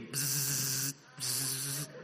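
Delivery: low buzzing of a razor; low mouth-made motor noise; imitated buzz. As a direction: buzz like hair clippers. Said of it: "si escuchas un sonido como bzz".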